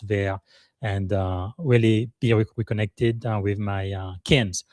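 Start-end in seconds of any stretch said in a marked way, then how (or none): none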